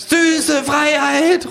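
sehr hoher, lang gedehnter Ausruf; rufendes Känguru. Acted. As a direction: selig und langsam ausrufen. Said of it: "ruft das Känguru". (hoher, gedehnter Känguru-Ruf)

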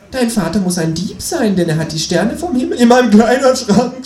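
high voice rising into a shout; desperate cry. A narrator's reading. Narrator confessing under pun torture, desperate cry. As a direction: break into a loud, high, hammed-up scream. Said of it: "schrei ich verzweifelt".

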